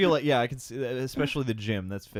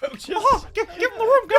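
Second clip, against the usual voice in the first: very high squeaky cop; a shrill little cop; 7 dB louder than usual. Retold or imitated. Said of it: "She's gonna get higher and higher".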